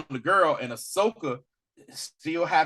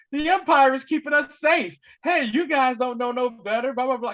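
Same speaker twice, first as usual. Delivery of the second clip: higher, smug know-it-all voice; mock pro-Empire guy; 7 semitones higher than usual. Friend mimics a smug Empire supporter, higher voice. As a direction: play the preachy loyalist a bit high. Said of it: "there's always that one person".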